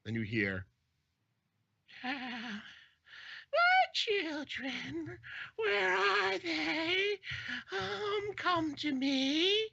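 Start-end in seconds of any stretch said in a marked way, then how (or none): 2.0-9.7 very high, slow, loud eerie wail; wailing witch. Acted. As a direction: beckon the children like a haunting witch. Witch's voice calls her children.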